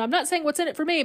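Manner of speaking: quick, high brush-off, acting put out; upset disclaimer. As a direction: rush through it as a mock disclaimer. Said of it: "Ray gets really upset".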